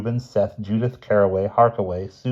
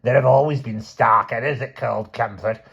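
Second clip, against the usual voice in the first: put-on higher, stubborn old woman's pronouncement; old matriarch insisting; 4 semitones higher than usual. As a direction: lay down family law, lightly aged voice. Aunt Ada's first statement.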